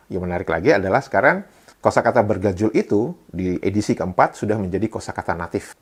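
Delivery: usual speaking voice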